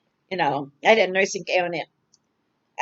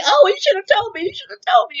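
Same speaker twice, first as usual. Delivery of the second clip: much higher, louder startled protest; dismayed friend; 13 semitones higher, 7 dB louder than usual. Retold or imitated. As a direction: play the friend's shocked dismay, high and loud. Friend reacts to the lost money.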